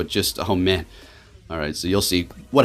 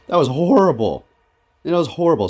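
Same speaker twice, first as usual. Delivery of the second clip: higher-pitched, emphatic dismay; exasperated complaint; 8 semitones higher, 4 dB louder than usual. Real, not acted. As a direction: lean hard on "horrible" in frustration. Complaining about losing to lag.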